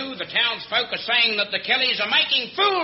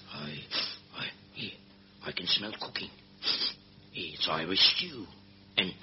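hushed low whisper; 11 semitones lower, 9 dB quieter than usual. Acted. Sneaking up on lifeboat.

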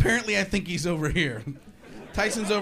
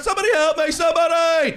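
panicked yell for help; 10 semitones higher, 5 dB louder than usual. Acted. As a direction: shout it out, high and desperate.

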